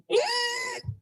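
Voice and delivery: tiny high squeaky laugh; whistle-note squeal. Retold or imitated. Streamer ending [name] bit, high squeal laugh. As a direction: squeal way up top like a diva. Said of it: "She sounds kinda like that".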